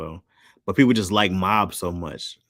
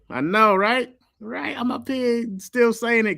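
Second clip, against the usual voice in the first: playful; 4 dB louder, slower than usual. Real, not acted. Mocking the ad joke.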